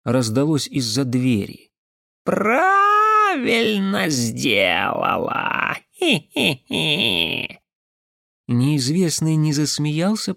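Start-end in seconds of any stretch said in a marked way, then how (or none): 2.2-5.8 very drawn-out high raven caw; каркающий голос. Acted. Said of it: "Каркающим голосом". 6.0-7.6 slow, high, squeezed-out fake giggle; forced giggle. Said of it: "будто выдавил из себя последние звуки".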